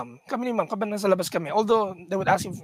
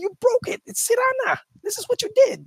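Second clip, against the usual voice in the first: higher scolding mom voice; mock angry mom. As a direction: scold like an upset mother.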